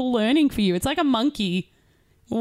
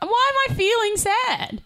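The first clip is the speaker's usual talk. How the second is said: whiny self-question; 11 semitones higher, slower than usual. Retold or imitated. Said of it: "I'm like".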